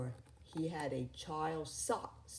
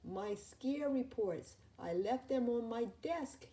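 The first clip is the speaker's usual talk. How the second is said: dismayed cry; 7 semitones higher than usual. A narrator's reading. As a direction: panic as you remember. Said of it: "he cried".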